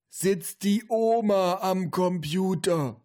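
slow, high-pitched grandpa call. A narrator's reading. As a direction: call from another room, every word drawn out. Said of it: "fragte der Opa". (rufender Opa, hoch und gedehnt)

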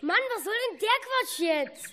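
high, exasperated complaint; fed up with this nonsense; boy grumbling about pointless steps; annoyed. Acted. Annoyed at silly treasure-hunt instructions.

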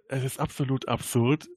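usual speaking voice

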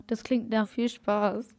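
ordinary talk, nothing marked

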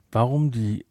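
ordinary delivery

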